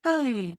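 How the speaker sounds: slow sigh falling away; demonstrated relaxing sigh; soft, full sigh. Shown as a demonstration. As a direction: breathe out fully in a gentle sigh. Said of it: "a sigh that's soft and slow and full".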